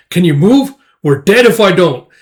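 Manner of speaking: urgent battle talk. Acted. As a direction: act comrades pressed in a fight.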